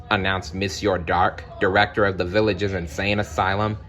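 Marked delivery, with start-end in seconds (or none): none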